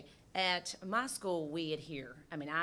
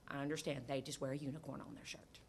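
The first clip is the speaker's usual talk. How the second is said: whispered aside; 4 semitones lower, 7 dB quieter than usual. Real, not acted. School principal to the board, a whispered aside. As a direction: drop to a whisper for the last line. Aside after quoting parents.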